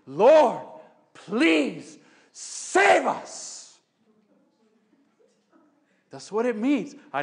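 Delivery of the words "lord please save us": pleading cry. Shown as a demonstration. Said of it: "Literally translated".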